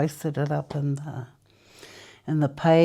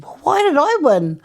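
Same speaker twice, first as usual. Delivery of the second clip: high, baffled question; puzzled surprise; 11 semitones higher, 6 dB louder than usual. Retold or imitated. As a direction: ask in disbelief. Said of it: "I said".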